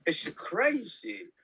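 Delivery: slow, high, hammed-up put-on accent; Christopher Walken. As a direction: draw it out in a silly mock accent. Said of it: "Christopher Walken, probably".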